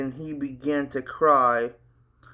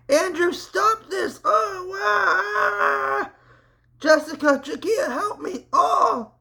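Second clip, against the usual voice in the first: high, much higher panicked cries; agonized screaming; 4 dB louder, slower than usual. Acted. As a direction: scream in terror and pain. Matt lifted and tortured by spirit.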